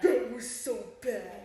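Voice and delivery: loud, drawn-out groaning complaint; slow, emphatic outburst of embarrassment; loud dismay. Real, not acted. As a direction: stretch it out loudly, mortified. Recalling an embarrassing moment together.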